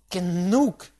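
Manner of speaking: loud, impatient cut-off; a loud, impatient "enough" to silence him; impatient interruption. A narrator's reading. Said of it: "unterbrach ich ihn ungeduldig".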